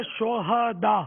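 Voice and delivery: شہداء in three slow syllables, sho-ha-daa; correct pronunciation. Shown as a demonstration. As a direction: open the middle "ha" with a short a in شہداء. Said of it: "یہ صحیح تلفظ ہے".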